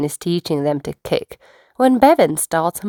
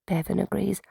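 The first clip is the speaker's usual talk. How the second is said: usual speaking voice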